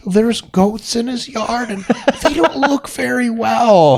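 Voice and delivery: put-on high, slow, dithering old lady calling it in; hammed-up hippie neighbour with a laugh in it. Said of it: "old hippie lady living next to him".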